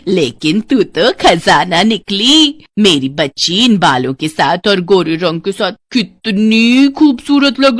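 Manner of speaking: slow, drawn-out fawning with sly chuckles; gushing stepmother. Acted. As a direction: fawn over the stepdaughter, stretching key words. (चापलूस सौतेली माँ)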